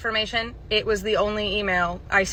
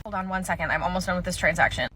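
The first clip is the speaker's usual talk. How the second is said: usual speaking voice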